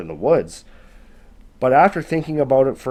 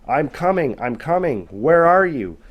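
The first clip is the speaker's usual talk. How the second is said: mildly raised storyteller's yell, building to a louder final question; calling out; 5 semitones higher, 5 dB louder than usual. A narrator's reading. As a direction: call out as if searching, without a full shout. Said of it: "she just kept yelling out".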